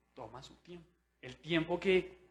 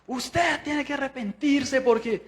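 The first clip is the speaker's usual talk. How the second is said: scolding grandma; 10 semitones higher, 6 dB louder than usual. Retold or imitated. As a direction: imitate a preachy grandma scolding. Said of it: "las abuelas tienen como". (abuela regañona imitada, aguda y lenta)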